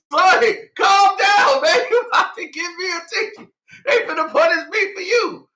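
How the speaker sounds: high, loud, laughing plea to the cop; half-laughing mock panic at the cop. Retold panic at cops, laughing.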